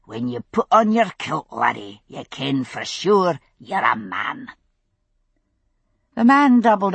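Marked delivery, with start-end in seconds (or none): 0.0-4.5 put-on thick Scottish accent. Roger quoting his granda to the vendor in thick Scottish accent. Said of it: "thickening his accent atrociously".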